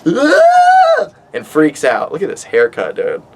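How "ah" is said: a tiny, very high squeak for the bat; bat shriek. Retold or imitated. Bat panics under the towel, high squeak. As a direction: mimic the bat's panicked shriek. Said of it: "this bat is like".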